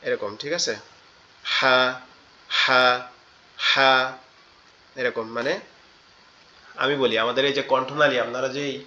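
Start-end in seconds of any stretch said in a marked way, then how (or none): 1.4-3.9 "হা" with a hissing rasp from the throat; raspy throat ha. Shown as a demonstration. Teacher shows a raspy "হা", like a hissing duck. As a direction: breathe a raspy "হা" like a goose. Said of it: "ফ্যাস ফ্যাসা আওয়াজের মতো".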